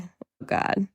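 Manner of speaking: usual speaking voice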